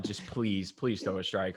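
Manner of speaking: very soft, low mock prayer; hushed pleading. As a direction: beg quietly under your breath. Pleading under the breath for pitcher.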